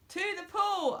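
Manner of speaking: high yell; boy yelling back. A narrator's reading. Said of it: "I yelled".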